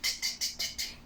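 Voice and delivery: "t" as quiet voiceless taps; repeated "t" with no vowel; isolated T sound. Shown as a demonstration. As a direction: tick out a row of bare "t" sounds. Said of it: "Last sound".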